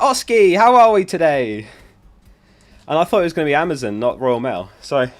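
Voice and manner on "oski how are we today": cheery postman greeting. Retold or imitated. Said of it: "he's always like".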